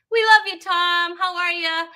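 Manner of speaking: high, loud, affectionate shout-out; excited greeting. Real, not acted. Host greets Tom as he joins the show, excited. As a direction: call out a high-pitched hello to a fan. Greeting an arriving audience member.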